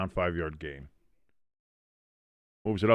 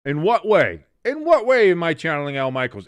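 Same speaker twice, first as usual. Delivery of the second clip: loud, high mock-offended question; incredulous; 6 semitones higher, 7 dB louder than usual. Real, not acted. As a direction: demand to know what the chat meant. Reacting to a chat comment.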